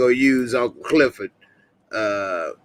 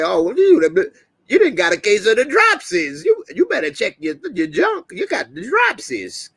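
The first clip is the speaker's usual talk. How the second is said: mocking voice; 9 semitones higher than usual. Vlogger teasing a viewer in his live chat, high mocking voice. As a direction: tease in a high, hammed-up voice.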